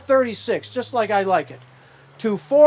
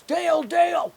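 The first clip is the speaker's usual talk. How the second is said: raised, higher call across a big hall; calling out a name; 6 semitones higher than usual. Retold or imitated. A guy calling to Dale across the courthouse rotunda, raised voice. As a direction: hail him from across the rotunda.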